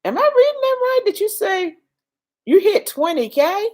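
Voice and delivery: can this be true; high incredulous question; amazed disbelief. Real, not acted. Astonished at viewer's milestone.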